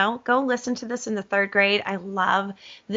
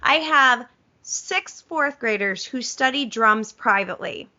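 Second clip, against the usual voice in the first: ordinary talk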